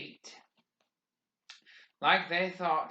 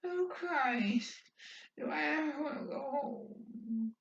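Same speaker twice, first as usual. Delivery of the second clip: longing; 11 semitones higher, 5 dB quieter than usual. A narrator's reading. Someone longing quietly.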